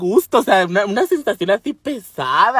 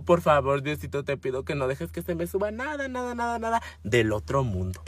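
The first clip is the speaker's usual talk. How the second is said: soft, hushed begging; pleading bedtime prayer; 4 semitones lower, 7 dB quieter than usual. Retold or imitated. Traumatised sleeper praying to God at night, hushed pleading. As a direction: murmur a little prayer, mostly low.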